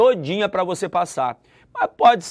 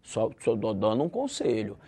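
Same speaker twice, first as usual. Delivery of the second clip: low, much softer friendly warning; advising hush; 6 semitones lower, 7 dB quieter than usual. Shown as a demonstration. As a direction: murmur "Ó, saia" as quiet advice. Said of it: "vou dar uma ideia".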